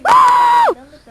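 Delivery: high frantic shout; being chased. Real, not acted. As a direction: cry out as it hurts you. Creature hurting him.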